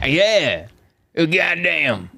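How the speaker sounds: hint of a wrestler's drawn-out exclamation; Stone Cold Steve Austin. Host continuing a wrestler bit, wrestler voice. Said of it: "he is Stone Cold Steve Austin".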